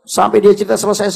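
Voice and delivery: normal talking voice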